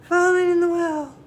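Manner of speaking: high, loud, broken gasping phrases; out of breath. Acted. Chang, worn out, gasps to his mother in pieces. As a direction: gasp the name out in broken bits. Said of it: "So he's so tired".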